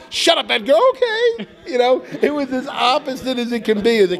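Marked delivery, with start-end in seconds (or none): 0.1-1.5 mock Chloe snap. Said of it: "when I was Edgar, it was like".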